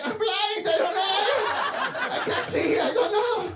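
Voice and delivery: put-on very high, frantic Latin-accented excuses; pretending to be blind. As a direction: squeal fake innocence in a Spanish accent. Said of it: "they're just pretending to be blind".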